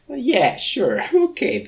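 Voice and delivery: very high sarcastic squeak. Pretend. Reader voicing Remus, sarcastic high squeak. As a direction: squeak out a fake agreement.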